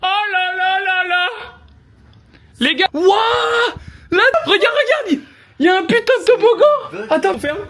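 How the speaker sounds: high, loud, laughing amazement; amazed shouting. Real, not acted. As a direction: shriek with delight at the house. Discovering the rental house.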